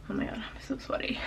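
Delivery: half-laughing whispered aside about the sweat; breathless whisper. Real, not acted. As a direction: whisper it as if sharing a secret. Sweaty after cardio, aside.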